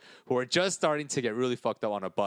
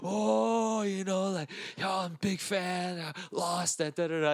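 higher, fanboy babble; gushing fan; 6 semitones higher, slower than usual. Retold or imitated. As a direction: rave like a drunk admirer. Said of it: "he started freaking out".

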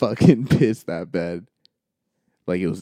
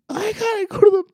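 put-on high wailing little-kid cry; mock crying child; imitating a crying kid. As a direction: whimper it out like a scared four-year-old. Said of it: "And he starts crying".

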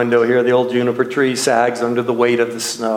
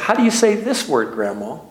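put-on high-pitched young reader, dropping on Grandma; retold childhood question in a higher voice; puzzled child reader; 4 semitones higher than usual. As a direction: ask like a stumped kid, pitched up. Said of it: "I said".

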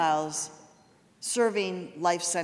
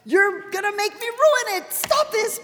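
high, loud, squealing protest about her makeup; playful high-pitched scolding, almost crying; mock high squeal; 14 semitones higher, 8 dB louder than usual. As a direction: shriek it like a teary friend begging him to stop. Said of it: "I was like".

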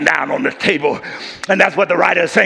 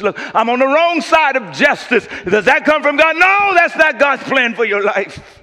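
rising, louder preacher's build; climbing sermon cadence; preaching at full force; 10 semitones higher, 4 dB louder than usual. Acted. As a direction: drive it home to the congregation.

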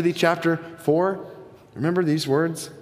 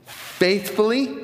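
"Faithfully." drawn out slowly and pitched higher; emphasized word; 7 semitones higher, slower than usual. Real, not acted. Preacher telling congregation how leaders must speak, "Faithfully." slowly emphasized. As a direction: lean hard on "Faithfully.", stretching it out. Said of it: "I emphasize that word".

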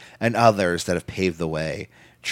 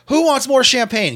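high, louder celebratory call-out; excited party shout; 5 dB louder than usual. Retold or imitated. Wrestler calls to friends to celebrate, excited high shout. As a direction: whoop it up offering the round.

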